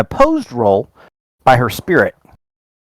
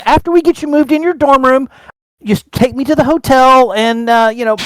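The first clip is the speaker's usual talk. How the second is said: high mum voice; 10 semitones higher than usual. Acted. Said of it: "She said".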